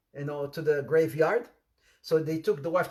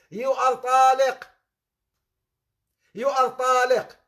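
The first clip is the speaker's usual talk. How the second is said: calling out a divorce to a grave, loud and raised; mock loud declaration; 8 semitones higher, 7 dB louder than usual. Bedouin shouting divorce at his dead wife's grave, loud.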